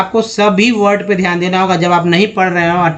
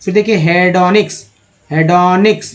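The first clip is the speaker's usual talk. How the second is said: ordinary talk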